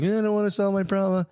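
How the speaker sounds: high-pitched mocking imitation; complaining babe. Man mimicking babes who talk and talk, mocking. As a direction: mimic someone who won't stop complaining. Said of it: "when you talk and talk and they're like".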